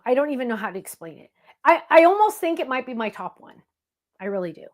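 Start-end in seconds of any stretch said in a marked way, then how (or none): none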